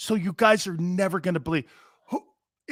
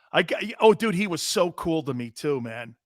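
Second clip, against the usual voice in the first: usual speaking voice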